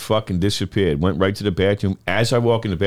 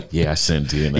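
porn-set voice; 8 semitones lower than usual. Pretend. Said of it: "make it sound like a porn set".

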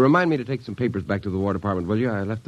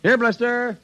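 calling the dog; slower than usual. Acted.

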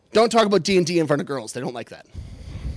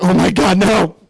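panicked; 7 dB louder than usual. Retold or imitated. Realizes he peed on his pants.

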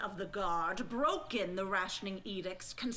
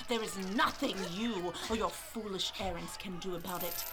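ordinary talk, nothing marked

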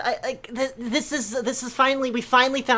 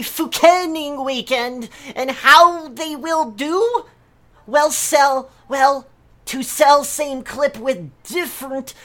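mock outrage; 5 semitones higher, 6 dB louder, slower than usual. Ranting forum post in broken English.

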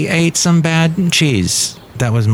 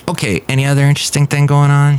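ordinary talk

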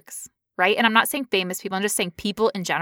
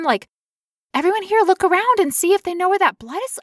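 feigned innocent concern; 6 semitones higher than usual. Retold or imitated. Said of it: "I very calmly said".

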